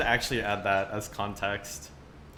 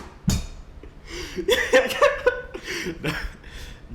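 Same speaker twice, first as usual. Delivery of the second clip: high breathless cackle; shrieking laugh; 6 dB louder than usual. Real, not acted. Laughing at thread text.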